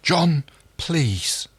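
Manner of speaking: high, pleading "John, please"; Aaron pleading with Crichton, high and timid. Acted. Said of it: "Aaron's voice was timid, pleading".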